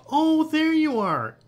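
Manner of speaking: high, loud, long-drawn greeting; exclaiming. A narrator's reading. Bird spots the missing chicken, exclaiming. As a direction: cry out on finding the lost one. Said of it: "it exclaimed when it saw the chicken".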